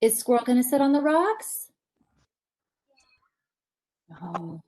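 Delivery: plain reading voice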